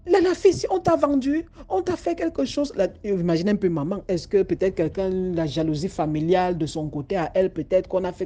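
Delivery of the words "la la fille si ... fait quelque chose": high, anxious outburst; worried mother. Acted. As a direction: fear someone harmed her girl. Mother fears daughter was cursed. (mère inquiète, voix aiguë)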